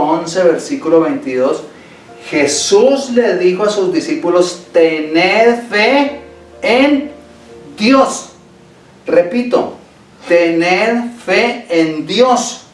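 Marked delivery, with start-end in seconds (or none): none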